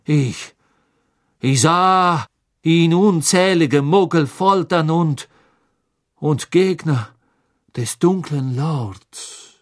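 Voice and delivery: laut, langsam, stockend; stockender Karkaroff. Acted. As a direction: eifrig belasten. (Karkaroff belastet Dolohov stockend)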